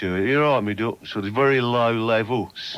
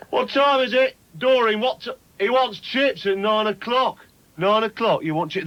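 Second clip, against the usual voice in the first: mocking chip shop woman; 10 semitones higher than usual. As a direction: shriek in mock disbelief at the customer. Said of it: "slovenly and rather dismissive like that".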